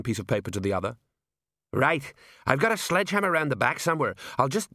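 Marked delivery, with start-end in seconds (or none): 1.7-4.7 higher-pitched, keen sergeant, cut short; eager sergeant. Acted. Sergeant offers to smash the golem, eager. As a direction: jump in with a plan, higher.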